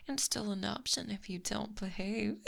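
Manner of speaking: normal talking voice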